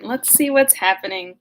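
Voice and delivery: ordinary delivery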